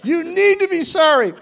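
loud, high-pitched mock scolding; imitated scolding wife. Wife berates apologizing husband, imitated in a high, loud scold. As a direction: lay into him in a shouted wife impression. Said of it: "Here she goes".

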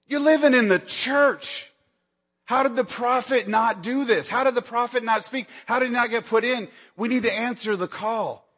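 rising preacher's indignation; preaching. Acted. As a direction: press the congregation hard, pitch up. Preacher builds fervour about Eli.

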